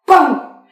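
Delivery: onomatopée criée très fort; coup crié. Acted. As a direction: frappe du son d'un coup. Father's outburst. (Bam crié très fort)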